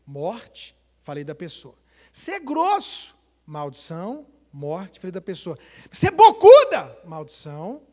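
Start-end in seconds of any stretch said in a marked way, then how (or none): 2.1-3.2 accusing snap. Retold or imitated. Husband accusing wife, example. 5.9-7.0 high, loud accusing shout; angry accusation. Retold or imitated. Husband in the car insulting his wife, angry shout.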